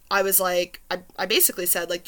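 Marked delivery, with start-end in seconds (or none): none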